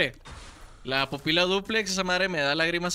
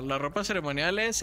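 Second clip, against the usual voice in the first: ordinary talk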